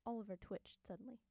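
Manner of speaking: ordinary delivery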